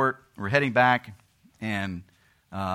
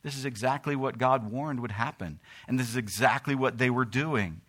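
fiery sermon voice; preaching at full force; 3 semitones higher than usual. Acted. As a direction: thunder it from the pulpit. Preacher reading scripture.

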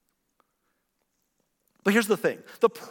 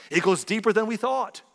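mock dramatic conspiracy line; 6 semitones higher, 4 dB louder than usual. Conspiracy catchphrase again.

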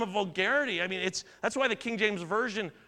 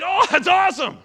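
high, loud happy burst; learning the charge was really a credit, loud and high; delighted relief; 9 semitones higher, 10 dB louder than usual. Retold or imitated. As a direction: let the relief spill out. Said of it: "I was like".

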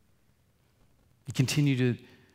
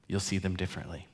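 "You'll see them differently." echoed much softer and quicker; soft fast repeat; 5 semitones lower, 9 dB quieter, faster than usual. Real, not acted. Pastor echoing to congregation, soft and quick. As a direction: let the repeat fall away softly.

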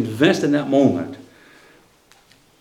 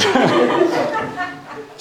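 high laugh rising out; a real, high laugh; burst of laughter. Real, not acted. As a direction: crack up at the punchline. Laughs again after "she didn't work.

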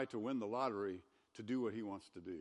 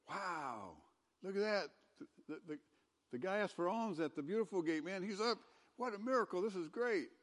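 higher, awed mock excitement; excited crowd; 6 semitones higher than usual. Pastor voicing the amazed crowd, higher and gushing. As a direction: gush over the miracle, lightly acted. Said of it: "They're all excited".

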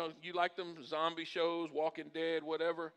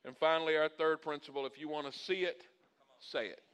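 ordinary talk

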